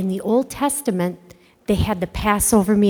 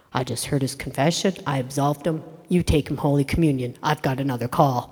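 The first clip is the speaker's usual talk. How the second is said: calm low priest voice; 6 semitones lower, 5 dB quieter, faster than usual. Retold or imitated. Voicing the smiling priest.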